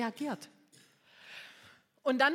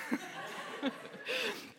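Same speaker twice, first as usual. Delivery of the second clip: high laugh; 11 semitones higher, 6 dB quieter than usual. Real, not acted. Laughing at own impulsiveness.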